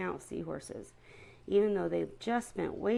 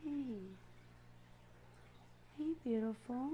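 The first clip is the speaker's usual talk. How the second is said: soft, higher sweet talk to a fish; pet talk; 6 semitones higher, 11 dB quieter than usual. Real, not acted. Owner softly sweet-talking her new seahorse in the tank.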